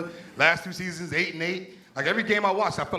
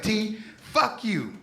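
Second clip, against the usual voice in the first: slow, raised-pitch telling-off; higher, drawn-out mock outrage; mock angry girlfriend; 7 semitones higher, much slower than usual. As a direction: imitate a girlfriend going off, words drawn out. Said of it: "She went off on me".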